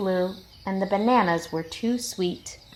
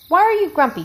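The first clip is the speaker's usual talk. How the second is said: curious lemur; 11 semitones higher, 8 dB louder, much faster than usual. A narrator's reading. Lemur questions Jim.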